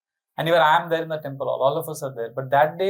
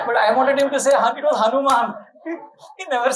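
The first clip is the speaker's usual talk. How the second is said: high, louder, through real laughter; laughing while telling; 7 semitones higher, 4 dB louder, much faster than usual. Real, not acted. Laughing at his own wish.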